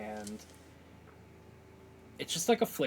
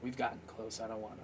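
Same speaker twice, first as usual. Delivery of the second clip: guarded softness; 8 dB quieter than usual. Acted. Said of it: "and he goes".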